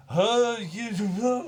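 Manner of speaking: high, loud wordless outburst; mock groaning reaction. Recalling a feeling that lingers to this day, high mock groan.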